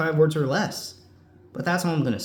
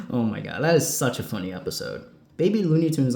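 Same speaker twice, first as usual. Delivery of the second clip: ordinary talk, nothing marked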